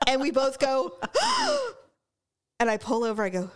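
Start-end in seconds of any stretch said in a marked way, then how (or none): 0.1-1.7 mock. Shocked at emergency vehicles.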